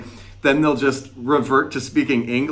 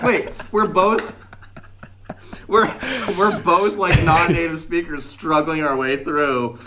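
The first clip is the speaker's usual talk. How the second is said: high-pitched, breaking up with laughter; amused realization; 7 semitones higher than usual. Retold or imitated. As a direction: retell the discovery in a raised, laughing voice. Acts out comic realization while laughing.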